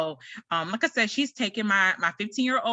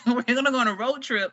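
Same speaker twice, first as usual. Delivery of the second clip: amused repeat; 5 semitones higher than usual. Real, not acted.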